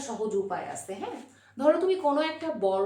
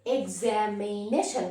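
Examination lower and very slow, four drawn-out syllable groups; split in four beats; 4 semitones lower, much slower than usual. Shown as a demonstration. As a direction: stretch each part of examination. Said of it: "চারবারে". (Examination টেনে, চারবারে)

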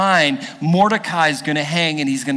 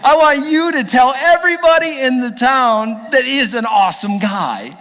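loud, higher royal command; king orders Haman to praise Mordecai publicly; excited king; 7 semitones higher, 4 dB louder than usual. Retold or imitated. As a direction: bellow the order with delight. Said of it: "the king was like".

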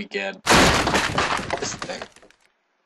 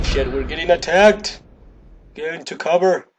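raised, louder warning; alarmed call; 6 semitones higher, 5 dB louder than usual. Real, not acted. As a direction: call out as the attack hits. Game character comes under attack.

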